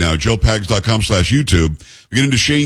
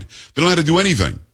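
mocking; 6 semitones higher, much faster than usual. Mocking Democrats' view of voters.

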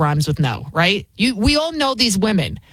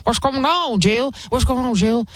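higher, confused old man voice, lightly put on; groggy Joe Biden; 6 semitones higher than usual. Wife wakes the president, mocking a dazed Joe. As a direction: play a dazed Biden just woken from a nap. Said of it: "He was like".

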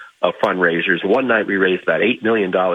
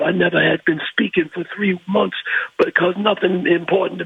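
put-on old man; 7 semitones higher than usual. Old nursing home man telling Ali his dream, higher and awed. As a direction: speak like a frail old fan, awed. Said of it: "He goes".

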